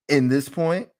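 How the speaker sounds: usual speaking voice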